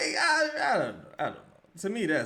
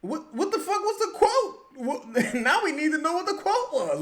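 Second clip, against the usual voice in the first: laughing while asking, voice pitched up; laughing demand; 8 semitones higher, 4 dB louder than usual. Real, not acted. Co-host laughs while demanding to hear the quote, high and amused. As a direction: laugh through the question.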